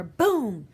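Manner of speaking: loud booming gunshot sound. A narrator's reading. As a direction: punch it out like a shot going off. Gunshot in the story.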